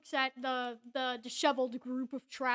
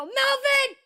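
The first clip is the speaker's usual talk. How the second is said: high, loud yell for her son; hammed-up mom shout, drawn to the back of the house; hollering mother; 9 semitones higher, 7 dB louder than usual. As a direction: holler upstairs for the boy.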